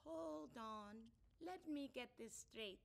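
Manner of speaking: slow, measured interjection; lightly voiced counselor. Counselor halting the couple's argument, slow and measured. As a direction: stop them to get it clear. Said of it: "said Counselor Dube".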